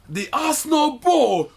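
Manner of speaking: high, slow screaming Cockney fan. Retold or imitated. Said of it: "that screamed".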